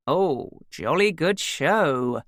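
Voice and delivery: slow, hammed-up plummy English accent; mock posh English. A narrator's reading. Son mocking the posh neighbour in a plummy English accent. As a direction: lay on a camp upper-class English drawl. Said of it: "camp flap of his hand".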